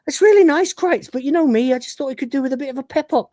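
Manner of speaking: Lister voiced. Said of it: "He goes".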